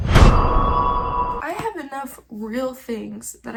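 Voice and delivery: loud, slow, strained crying burst; overwhelmed cry; loud outburst. Real, not acted. To viewers, bursting out in tearful despair.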